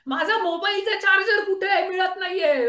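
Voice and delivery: high-pitched fussing over a lost thing; mock tantrum. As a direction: act out someone raising a racket about a missing charger. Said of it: "सगळं घर डोक्यावर घेतलं". (चढ्या आवाजात आरडाओरड्याची नक्कल)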